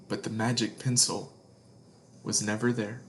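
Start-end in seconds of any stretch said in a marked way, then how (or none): none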